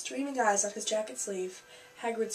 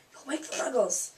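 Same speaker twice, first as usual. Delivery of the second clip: a higher, urgent scolding hush; urgent hiss; 4 semitones higher than usual. A narrator's reading. Professor warning the noisy giant, urgent hissed scolding. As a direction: hiss a warning, lightly acted. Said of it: "hissed Professor McGonagall".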